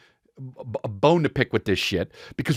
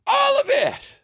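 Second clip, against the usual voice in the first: much higher, stretched-out all of it; mock cop; slower than usual. Cops answering the charge question, hammed-up. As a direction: play the cops with exaggerated finality.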